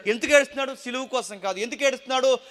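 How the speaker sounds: loud, high, fervent preaching; full-force preaching. Acted. Preacher thundering to the congregation about fear, loud and fervent. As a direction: drive the point home to the congregation. Preacher asking why he wept.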